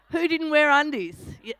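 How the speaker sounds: usual speaking voice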